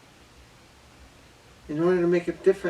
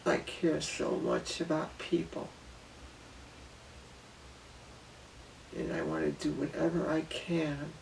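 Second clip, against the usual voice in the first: emotional hush; 10 dB quieter than usual. Real, not acted. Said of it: "I might get a little emotional here".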